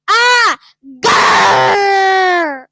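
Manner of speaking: loud cry then a snarling growl; scream, then growl through the teeth; raging witch scream and growl. Acted. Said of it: "gnashing her teeth and screaming with rage".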